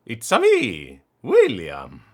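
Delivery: loud Italian plumber catchphrase; Mario voice. Pretend. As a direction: belt it out like Mario.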